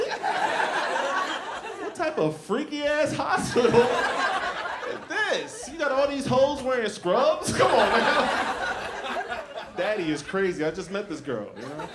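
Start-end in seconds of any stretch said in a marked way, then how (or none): 0.0-9.4 high, slow, laughing disbelief; laughing rant. Real, not acted. Comic asks audience about freaky hospital, high laughing disbelief. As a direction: drag it out in amused disbelief.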